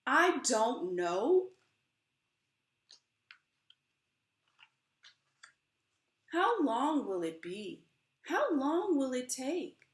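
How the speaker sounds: slow, swelling spoken-word performance of a poem; dramatic poem reading. Acted. Author reads her book to the audience, impassioned poem reading. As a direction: perform the poem with rising passion.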